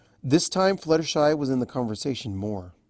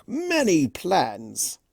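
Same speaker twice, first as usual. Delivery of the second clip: slow sly relish; 5 semitones higher, much slower than usual. A narrator's reading. Discord relishing his secret.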